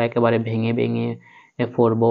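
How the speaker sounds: usual speaking voice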